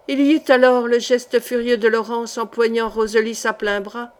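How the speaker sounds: plain reading voice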